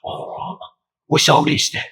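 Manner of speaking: mock British catchphrase, starting hushed; British accent. Shown as a demonstration. Host demonstrating his British go-to line, mock British accent. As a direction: slip softly into a comic British accent. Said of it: "so that's obviously British".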